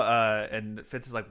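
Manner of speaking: usual speaking voice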